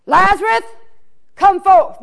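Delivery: a ringing summons to a dead friend; hollered call. Acted. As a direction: call him out of the tomb. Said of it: "he hollers out".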